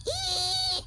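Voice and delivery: very high, slow screeching ee; brake squeal. A narrator's reading. Reading to children, very high braking screech. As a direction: let the brakes shriek long. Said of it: "the brakes go".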